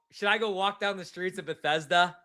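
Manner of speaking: normal talking voice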